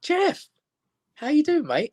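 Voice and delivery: mock-cheerful hi to the old bully; cheery greeting. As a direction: pitch it up, overly friendly. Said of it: "and I went".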